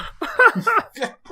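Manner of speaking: high, loud laughter. Real, not acted. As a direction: burst out laughing. Laughing at the bit.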